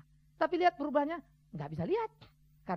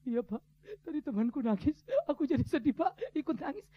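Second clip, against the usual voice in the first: imitated child's weepy explanation; mock tearful; 2 semitones higher than usual. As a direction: pretend to answer through sniffles. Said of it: "Kenapa kamu nangis?".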